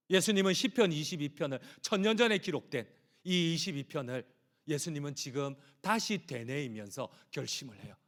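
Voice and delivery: normal talking voice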